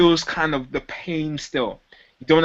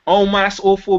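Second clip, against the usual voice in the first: overdone fake pity, a bit louder; disingenuous sympathy; 5 semitones higher, 4 dB louder than usual. Shown as a demonstration. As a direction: gush false sympathy. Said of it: "this will sound, like, very disingenuous".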